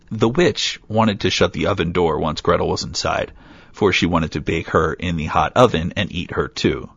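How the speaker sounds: ordinary delivery, nothing marked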